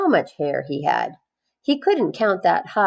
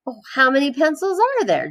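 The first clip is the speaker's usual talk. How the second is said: Joe asking; 8 semitones higher, faster than usual. A narrator's reading. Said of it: "Joe asked".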